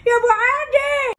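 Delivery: loud, slow, high wail to the heavens; mock wail. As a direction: call out to her dead husband, hammed-up. Grandma cries out to late husband. (ولولة عالية بتمثيل)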